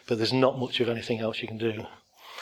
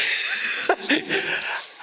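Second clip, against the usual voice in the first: breathy laugh. Real, not acted. Laughs at own reply.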